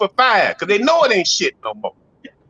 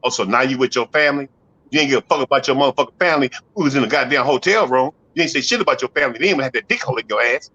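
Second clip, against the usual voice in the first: jealous lover; 6 semitones lower, faster than usual. Retold or imitated. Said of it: "he get mad than a motherfucker".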